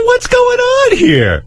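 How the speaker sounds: very high, drawn-out exclamation; hammed-up incredulous protest; baffled outrage. Grown child confronting parents after being insulted, incredulous.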